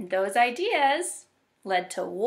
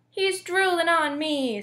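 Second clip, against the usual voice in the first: slow, raised whine of protest; complaining; 7 semitones higher, 4 dB louder than usual. A narrator's reading. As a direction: drag out the complaint, pitched up. Dot complains about the baby.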